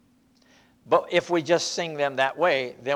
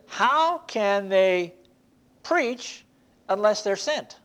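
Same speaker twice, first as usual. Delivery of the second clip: rising preaching; 3 semitones higher, slower than usual. Acted. Preaching question building.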